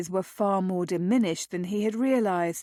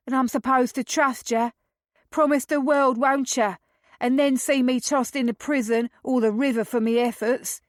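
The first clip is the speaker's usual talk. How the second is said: higher, louder scornful Cockney youth; defiant Cockney lad; 5 semitones higher, 3 dB louder than usual. Acted. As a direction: sneer it like a street boy. Cockney lad defies them.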